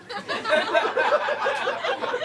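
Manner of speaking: high long laugh; cracking up completely. Real, not acted. Reader laughs hard.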